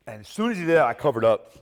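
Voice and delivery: usual speaking voice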